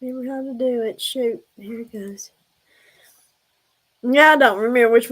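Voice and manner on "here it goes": whisper. Real, not acted. Starting the clip quietly.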